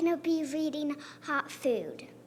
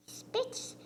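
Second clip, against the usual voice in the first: a sharp spitting puff on spits; spitting sound; 8 semitones higher, 7 dB quieter than usual. Pretend. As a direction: spit the word out like hot food. Dad spits out hot potato.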